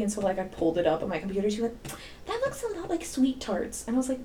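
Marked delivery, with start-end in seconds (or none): 2.3-3.8 student impression. Voicing one of the girls.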